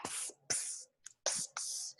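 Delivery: whispered psst to get attention; urgent psst. Acted. Prisoner signals to the adjacent cell, whispered psst. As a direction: hiss "psst" very softly, several times. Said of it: "is frantically trying to say".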